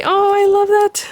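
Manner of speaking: very high, slow coo of delight; adoring gush. Real, not acted. Crafter adores the Tooth Fairy stamp, adoring gush. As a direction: coo it sky-high, stretching the words. Gushing over a stamp sentiment.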